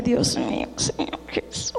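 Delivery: breathless, whispered Dios mío in quiet shock; whispered dismay. Retold or imitated. Mother seeing her broken lamp, gasping a whispered prayer. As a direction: breathe it out, stunned and hushed.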